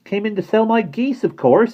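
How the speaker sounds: put-on high, loud and cheeky boy's voice; cheerful boy. Simon explaining himself to Bonnie, cheerful and cheeky. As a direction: toss it off with a wink. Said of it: "he said, winking cheerfully".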